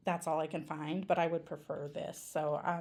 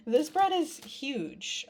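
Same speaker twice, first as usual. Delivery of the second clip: amazed; 6 semitones higher, 4 dB louder, much slower than usual. Real, not acted. Amazed at big bread.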